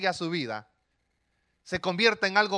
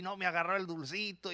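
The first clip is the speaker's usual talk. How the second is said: put-on softer, sniffly sulking child; a hint of a heartbroken boy whining; mild mock sob of a rejected little boy; crying little boy; 9 dB quieter than usual. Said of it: "Él llora y".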